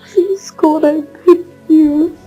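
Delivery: high, drawn-out begging; anguished pleading. Acted. Woman begging God while being comforted, anguished pleading. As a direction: beg slowly, voice lifted in distress.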